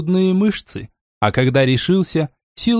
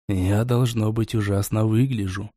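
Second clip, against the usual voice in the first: cracked android voice; 5 semitones lower, 5 dB quieter than usual. A narrator's reading. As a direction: speak like a damaged android, hoarse and cracked. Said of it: "хрипловатый, надтреснутый голос".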